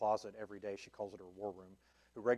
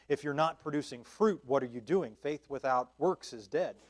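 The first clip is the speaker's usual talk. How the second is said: louder, slow, driving sermon voice that builds each phrase; fervent preaching; 3 semitones higher, 6 dB louder than usual. Acted. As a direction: preach it, pushing every line harder. Preaching at full force on works.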